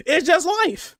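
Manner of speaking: a shaky laugh breaking through the words; laughing through tears. Real, not acted. Streamer laughs to chat about his mom, choked laughter. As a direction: laugh it off while close to tears.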